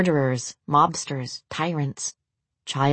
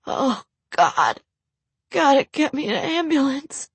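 injured man; 4 semitones higher than usual. Acted. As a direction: beg for help through pain.

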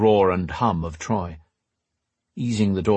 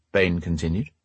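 usual speaking voice

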